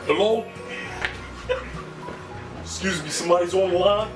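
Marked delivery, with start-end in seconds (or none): none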